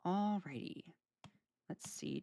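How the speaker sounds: breathy whisper to herself; a private whispered aside; whispered alrighty. Real, not acted. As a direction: whisper it as if thinking aloud. Muttering to herself between comments.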